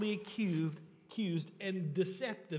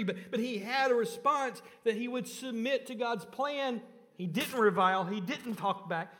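higher, louder pulpit voice driving each line home; impassioned preaching; 4 semitones higher, 4 dB louder than usual. Acted. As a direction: preach it with fervour, hammering every clause.

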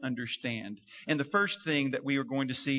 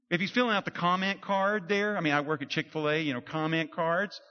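normal talking voice

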